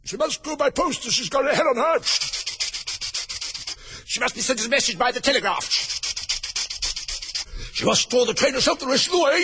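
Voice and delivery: high-pitched passenger calling out; other passengers' voices. Acted. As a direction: pipe up forcefully in a high voice. Other voices call out in turn.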